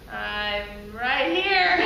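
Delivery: exaggeratedly slow comic reply; drawn-out answer. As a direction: stretch each word out awkwardly. Embarrassed woman answers lover in story.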